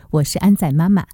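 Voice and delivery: ordinary delivery, nothing marked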